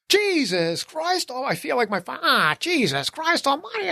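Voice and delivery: drawn-out, slow exasperated Jesus Christ; retold car rant. Man rants alone in his car about rude people. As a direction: rant slowly to yourself at the wheel. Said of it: "ranting and everything".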